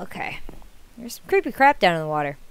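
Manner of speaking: hushed nervous whisper. Real, not acted. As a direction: breathe it out, scared. Spooky night water, alone.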